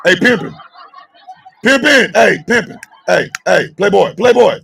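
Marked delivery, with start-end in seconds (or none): none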